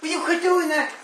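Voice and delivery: high, exasperated shout at a friend; annoyed yell. Real, not acted. Yelling at his buddy to quit blowing stuff up, annoyed. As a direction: tell him off sharply. Friend keeps setting off fireworks.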